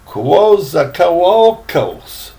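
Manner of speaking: Coatzacoalcos said slowly, syllable by syllable; careful pronunciation. Shown as a demonstration. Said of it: "some port I can't even pronounce".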